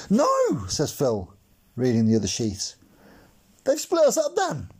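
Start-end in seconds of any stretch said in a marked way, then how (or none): 0.1-0.7 much higher, louder cry of no; anguished no. Acted. Phil cries out on seeing they are split up. 3.6-4.7 dismayed. Acted. Phil tells Dan the classes split them, dismayed.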